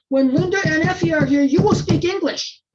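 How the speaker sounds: put-on high, loud scolding of an old lady. Grandmother scolding her mother-in-law, loud and high. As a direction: tear into her for speaking Hungarian. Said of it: "my grandmother lit into her".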